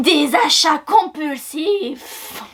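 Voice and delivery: very slow, grave trailer announcer. Acted. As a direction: intone it like a blockbuster teaser. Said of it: "voix de bande-annonce".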